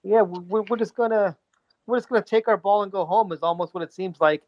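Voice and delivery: ordinary talk, nothing marked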